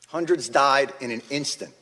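ordinary delivery

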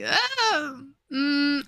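high, drawn-out uneasy noises; mock-uneasy hesitation; wary reluctance. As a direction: imitate someone shying away, high-pitched. Acting out Casca's wary reaction.

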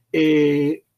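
ordinary talk, nothing marked

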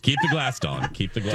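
copying the jingle announcer; ad voice imitation. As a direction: echo the commercial announcer. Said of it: "you do a really good imitation of her".

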